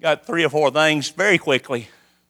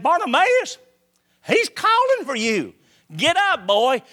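high call to Bartimaeus, much higher; 7 semitones higher than usual. Retold or imitated. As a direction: call him up eagerly across the road. Crowd calls to blind man.

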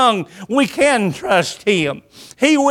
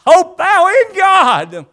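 higher, drawn-out preaching cry; urgent exhortation; 8 semitones higher, 4 dB louder, slower than usual. Retold or imitated. Preacher voices psalmist rousing his own soul, urgent exhortation. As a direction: slowly proclaim it with rising force.

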